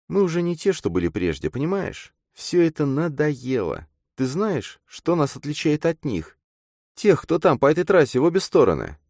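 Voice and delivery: put-on high-pitched girl's voice; disillusioned girl character. A narrator's reading. Girl tells her friend what sets them apart, weary of it.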